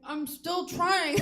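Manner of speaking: slow, high, loud strained words; mock mouth-full protest. Woman answering Siri mid-act, muffled mock exasperation.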